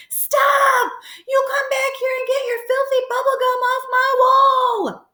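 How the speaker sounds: very high, loud furious shout; yelling at full volume. A narrator's reading. Bookstore owner chasing a kid down the street, high loud yell. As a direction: holler down the street at the top of your lungs. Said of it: "yelling at the top of his lungs".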